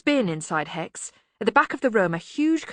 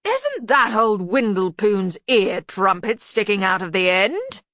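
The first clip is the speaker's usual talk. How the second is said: loud, slow suspicious question; 3 semitones higher, 6 dB louder, slower than usual. A narrator's reading. Arch-Chancellor spotting the ear trumpet, suspicious. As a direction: query it pointedly and slowly. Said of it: "said Ridcully suspiciously".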